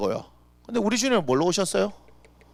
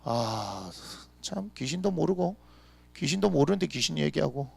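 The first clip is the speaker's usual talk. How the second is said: normal talking voice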